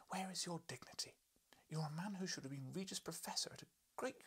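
high, exasperated scolding; a wife's high scolding. Acted. Wife to husband George, high exasperated scolding. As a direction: berate him in a high voice.

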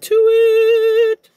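last words stretched into a loud, high squeal; hyped kick-off. Real, not acted. Vlogger kicking off the meal prep plan, hyped squeal. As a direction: launch it with a long, piercing, high-pitched "to it".

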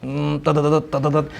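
low mock beeping of a metal detector. Preacher mocking a snooping worshipper, beeping like a detector. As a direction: hum it like a gadget scanning.

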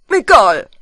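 loud dismissive word; loud doctor. Acted. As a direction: brush it off loudly. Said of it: "sagt der Doktor".

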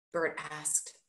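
ordinary delivery, nothing marked

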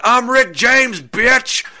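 high, loud whooping shout; comic yell. Acted. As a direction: holler it like a catchphrase down the street. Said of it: "running back and forth through the streets yelling".

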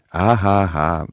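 put-on, spelled-out laugh; hammed-up insincere laughter; awful fake laugh. A narrator's reading. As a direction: laugh falsely, syllable by syllable. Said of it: "mit diesem furchtbaren, aufgesetzten Lachen".

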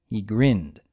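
usual speaking voice

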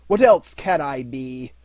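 loud, slow, irritable; exasperated old miser; cross retort. A narrator's reading. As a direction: snap back as a grumpy uncle.

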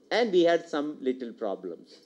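ordinary talk

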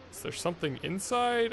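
usual speaking voice

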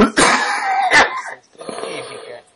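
words broken by a hacking cough; coughing hard mid-sentence; chesty cough. Real, not acted. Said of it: "Madonna, che tosse". (tosse di petto)

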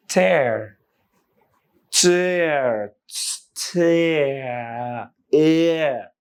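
"tear" stretched slowly so the "air" glide shows; drawn-out diphthong. Shown as a demonstration. Teacher showing learners the sound inside a word, drawn out. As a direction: hold the "air" inside "tear", falling away. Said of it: "টিয়ার ধীরে বললে".

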